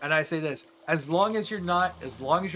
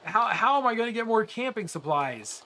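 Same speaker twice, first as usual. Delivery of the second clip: exasperated complaint; 6 semitones higher than usual. Real, not acted. Game shop won't sell more.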